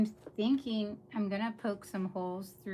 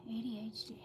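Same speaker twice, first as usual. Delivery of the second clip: soft whispered repeat; hushed, under the breath; whispered aside; 7 dB quieter than usual. Real, not acted.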